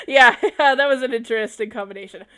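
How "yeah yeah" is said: loud laughing yeah; laughing at the awkward class story. Real, not acted. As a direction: laugh through the yeahs. Laughs loudly.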